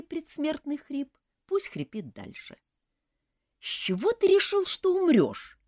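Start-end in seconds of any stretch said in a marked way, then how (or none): 3.6-5.6 irritated question. A narrator's reading. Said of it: "раздражённо спросила я".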